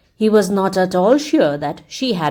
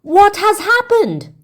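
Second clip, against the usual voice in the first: much higher, slow, alarmed; a crowd stunned by the frozen king; astonished cry; 12 semitones higher, 4 dB louder than usual. A narrator's reading. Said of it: "Everyone cried out".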